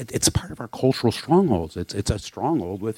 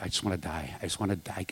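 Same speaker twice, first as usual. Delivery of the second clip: much softer, low retold despair; despairing murmur; 3 semitones lower, 13 dB quieter, faster than usual. Retelling crying out to God, soft and despairing. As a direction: let it sink to a hopeless hush. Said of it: "I'm like".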